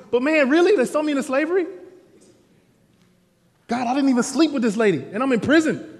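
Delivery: imprisoned Joseph complaining to God, exasperated; Joseph's frustrated lament. Acted. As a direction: cry out to God, wronged and fed up. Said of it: "Joseph is like".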